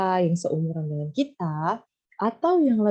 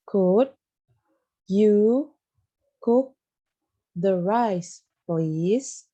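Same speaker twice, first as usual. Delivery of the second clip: word by word; much slower than usual. Shown as a demonstration. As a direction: break the sentence into single words to repeat. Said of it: "Gimana ya ngomongnya?". (kata demi kata, dengan tekanan pada "cook")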